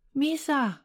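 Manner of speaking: high, drawn-out call of the name. Acted. Sara calls after her cat again, high call. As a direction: call the cat's name across the field. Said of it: "råbte Sara igen".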